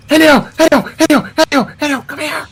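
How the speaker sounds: high, repeated little summons; calling out. Acted. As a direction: call a companion over in a high voice. Calling a companion over in game.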